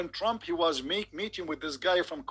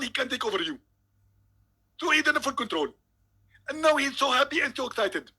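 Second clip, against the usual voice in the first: higher, louder, excited mock sermon; Zakir Naik impression; 5 semitones higher, 4 dB louder than usual. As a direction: preach it with gleeful conviction. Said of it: "If we call Zakir Naik".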